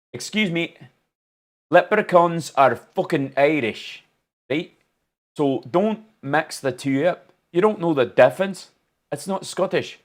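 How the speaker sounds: put-on high, indignant Scottish accent; mock-offended correction in a Scottish accent. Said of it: "I'm gonna have to do a Scottish accent".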